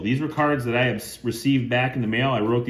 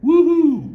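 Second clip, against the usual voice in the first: much higher, much louder whoop; excited woohoo; 12 dB louder than usual. Real, not acted. Collector cheering a blue Sharpie autograph, excited high loud whoop. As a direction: cheer like you hit the jackpot. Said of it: "gonna get a little excited".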